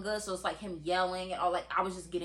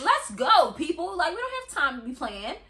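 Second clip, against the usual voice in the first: impatient; 7 semitones higher, 5 dB louder than usual. Retold or imitated. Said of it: "he was trying to".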